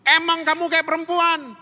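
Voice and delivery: loud high sneering question; mock the son like a cruel father; hammed-up sneering dad. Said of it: "papanya ngomong apa".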